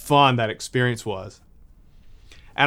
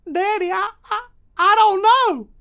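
put-on young son's voice; 4 dB louder than usual. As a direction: stammer it out in a little boy's squeak. Son stumped by father's question.

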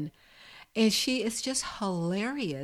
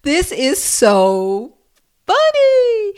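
laughing disbelief; 10 semitones higher, 12 dB louder, much slower than usual. Retold or imitated. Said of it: "And I was just like".

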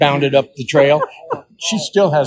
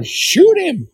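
urgent command. Retold or imitated. Father urging daughter to shoot the buck, high and urgent. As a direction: blurt it out pitched way up. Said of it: "I go".

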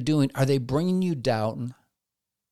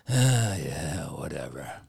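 mock apathy; 11 semitones lower, slower than usual. Apathetic listener voiced.

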